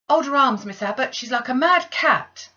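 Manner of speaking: loud, high, urgent cry for help; urgent shout. Acted. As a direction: shout to the other maid to grab her. Maid restraining struggling girl.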